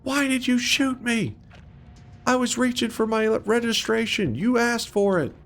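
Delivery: hammed-up high aggrieved voice; shot victim. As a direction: whimper it in a squeaky voice. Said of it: "He goes".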